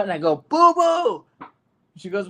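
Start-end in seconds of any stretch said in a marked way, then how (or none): 0.5-1.2 high, loud call across the field. Retold or imitated. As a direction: shout her name to get her attention. Dad calls daughter from stands.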